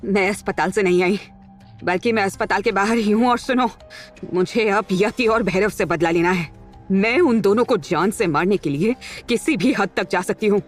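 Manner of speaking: seething anger held in, not shouted; angry, vengeful resolve. Acted. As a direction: spell out your revenge to an ally on the phone. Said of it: "उसका गुस्सा बुरी तरह से फूट पड़ा". (दबे गुस्से में बदले का इरादा)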